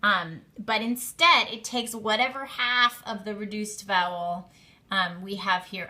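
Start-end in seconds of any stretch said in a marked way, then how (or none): none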